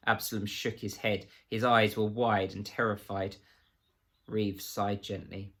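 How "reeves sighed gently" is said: usual speaking voice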